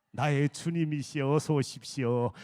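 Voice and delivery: low, drawn-out respectful greeting; reverent welcome. Shown as a demonstration. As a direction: welcome them low, as honoured lords. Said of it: "며느리가 지금 와요". (낮은 음으로 정중히 맞이하기)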